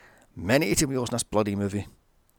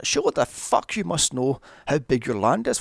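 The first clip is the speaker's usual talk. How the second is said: normal talking voice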